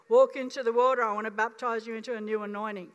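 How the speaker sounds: God insisting, firmer now. Retold or imitated. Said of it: "a little bit firmer".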